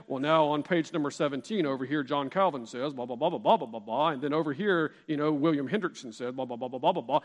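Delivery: low flat droning reader voice; monotone voice. Retold or imitated. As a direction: drone it like a dull lecturer. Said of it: "in a monotone voice and just read".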